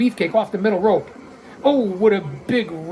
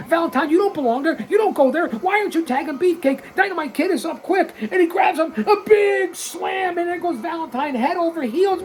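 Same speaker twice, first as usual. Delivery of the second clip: high, scolding play-by-play; heated call; 9 semitones higher than usual. Real, not acted. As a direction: warn him like a coach at ringside. Scolds Valentine on top rope.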